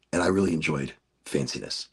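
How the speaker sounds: ordinary talk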